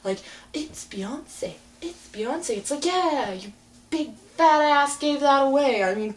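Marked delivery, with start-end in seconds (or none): 0.5-2.6 breathy whispered mockery of a singer. Mocking [name]'s video, whispered. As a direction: whisper it like a self-important star. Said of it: "But she's like".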